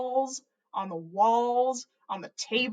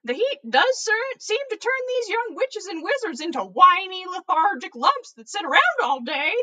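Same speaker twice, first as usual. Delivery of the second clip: higher, louder, twinkly put-on voice; jolly old wizard teasing the young ones; playful old wizard; 8 semitones higher, 7 dB louder than usual. A narrator's reading. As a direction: play the mischievous headmaster.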